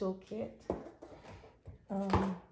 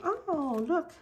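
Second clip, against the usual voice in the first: excited; 9 semitones higher, 5 dB louder than usual. Real, not acted. Delighted at the unboxing.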